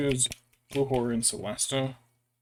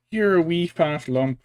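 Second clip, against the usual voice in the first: cooing; 5 semitones higher, 7 dB louder, slower than usual. Real, not acted. Cooing at pet bird.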